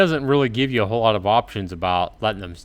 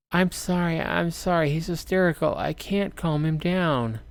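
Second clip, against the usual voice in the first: pleading mother; 9 semitones higher than usual. Retold or imitated. Mother apologizes to Jacob for her crying son, high and pleading. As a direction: beg forgiveness for a kid you can't calm. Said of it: "she's like".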